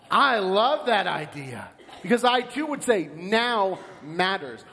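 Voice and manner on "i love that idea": ordinary delivery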